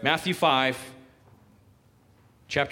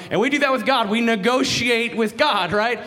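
emphatic preaching; 7 semitones higher, 5 dB louder than usual. Real, not acted. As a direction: drive the point home, loud and high.

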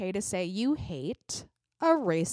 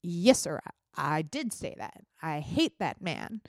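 unapologetic admission; 4 semitones lower, 4 dB quieter than usual. Acted. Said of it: "It's okay to be like".

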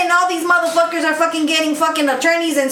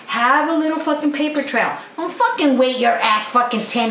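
ordinary talk, nothing marked

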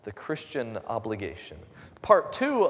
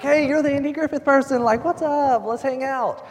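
excited greeting; 9 dB louder than usual. Retold or imitated. Excited greeting of new friend.

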